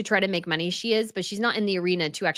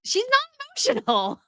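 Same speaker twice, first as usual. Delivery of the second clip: exasperated whine. Real, not acted. Host contradicting the guest's claimed emotion, exasperated whine. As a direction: whine it way up high in disbelief.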